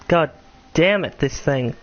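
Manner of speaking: normal talking voice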